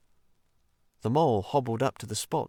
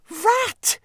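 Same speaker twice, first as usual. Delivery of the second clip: shrill, much higher cry of Rat's name; penitent cry; 7 dB louder than usual. Acted. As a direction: burst out in remorse. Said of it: "he cried in penitence".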